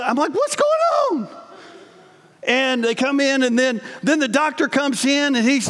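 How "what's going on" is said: high startled question; suddenly alarmed, voice jumps up. Retold or imitated. To the nurse, alarmed and high.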